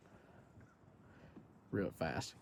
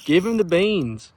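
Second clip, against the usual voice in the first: excited; 6 semitones higher, 5 dB louder, slower than usual. Real, not acted. Urging buddy fighting fish.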